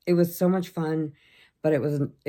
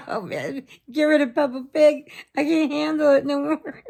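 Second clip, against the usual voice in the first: mock despair; 10 semitones higher, 5 dB louder than usual. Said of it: "so tired of watching Peppa Pig".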